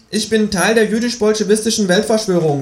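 high-pitched kangaroo, matter-of-fact. Acted. Kangaroo confesses to the narrator, high creature voice. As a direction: announce it high, as the talking kangaroo. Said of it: "sagt es".

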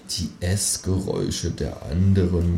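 soft, slow murmur. Acted. Narrator murmurs in the dark, soft slow murmur. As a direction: murmur it dreamily. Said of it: "murmel ich".

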